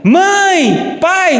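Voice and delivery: high-pitched call for a parent; child calling out. Acted. Pastor acting a child shouting for parents, calling out. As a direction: cry out like a kid calling mum and dad. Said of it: "se o seu filho grita".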